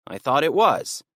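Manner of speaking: a different thief, a bit whiny; defensive second burglar. Acted. Burglar insisting the house was empty, defensive.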